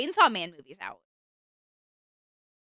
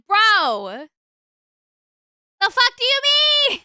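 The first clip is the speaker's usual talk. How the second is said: loud, high outraged shout; angry; 10 semitones higher, 9 dB louder, slower than usual. Retold or imitated. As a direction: yell it in disbelief. Retold outraged reaction.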